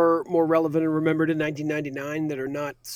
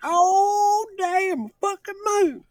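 mock amazed astronaut; much slower than usual. Joining the moon bit.

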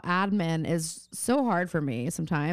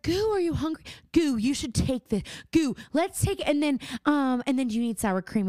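doting mom voice; 6 semitones higher than usual. Retold or imitated.

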